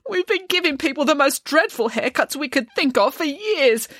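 high, tearful mock wail; sobbing hairdresser, voiced high; sobbing confession. A narrator's reading. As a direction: confess in a hammed-up weepy voice. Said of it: "admitted the sobbing chief hairdresser".

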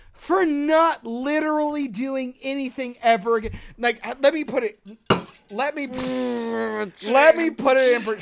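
loud, high, furious tirade at his friend; real angry rant. Real, not acted. Arguing with cohost Michael about residuals, loud angry rant. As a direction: tear into him, half joking but furious. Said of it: "I'm so angry".

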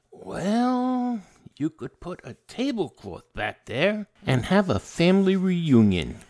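the honest friend answering about pants, loud and blunt; blunt honesty. Retold or imitated. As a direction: blurt the brutal truth loudly. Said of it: "honest to a fault".

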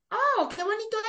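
high, excited gush over something pretty; retold burst of delight, pitched well up; delighted gasp. Retells admiring embroidery. (entusiasmo encantado, voz mucho más aguda)

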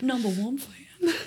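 slow country drawl; country accent. Pretend. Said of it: "In a country accent".

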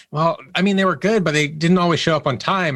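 imitating a reluctant reference; hesitant lukewarm reference. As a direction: hedge politely about someone you worked with. Friends giving a hesitant reference.

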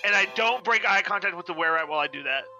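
ordinary talk, nothing marked

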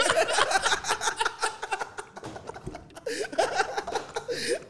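silent airy laugh way up high; real helpless wheezing laugh; high breathless wheezing laugh. Real, not acted. Laughing at friend's joke.

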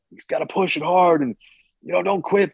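mock drill-sergeant push; lightly played tough trainer urging no quitting; hard-nosed trainer. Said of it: "that hard-nosed kinda trainer".

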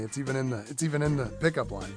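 ordinary talk

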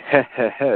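imitating a breathy heh heh heh chuckle; short clipped heh heh heh; evil chuckle. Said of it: "he got the wrong, uh, vowels in there".

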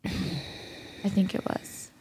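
slow, hushed whisper; slow unsure whisper. Real, not acted. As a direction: whisper it like a guess you aren't sure of. Unsure aside, dropping to whisper.

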